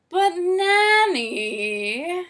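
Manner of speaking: high, loud, long-drawn whine; stretched-out, sing-song wail of protest. A narrator's reading. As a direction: wail it like a scolded child. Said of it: "he wailed".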